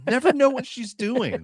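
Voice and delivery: high-pitched mock grumble through laughter; giggly, high impression of a complainer; imitated giggling complaint. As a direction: quote the character while cracking up. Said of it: "the way he says it too".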